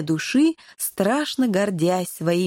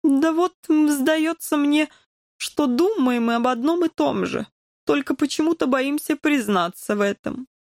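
high-pitched, hesitant cat voice; higher, tentative confiding voice; Korzhik character; 6 semitones higher than usual. A narrator's reading. Korzhik confessing in voiced dialogue.

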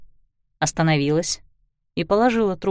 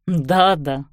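tossed off on the way out; 4 semitones lower than usual. A narrator's reading. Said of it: "бросил на ходу".

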